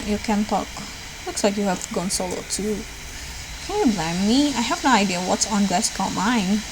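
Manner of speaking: normal talking voice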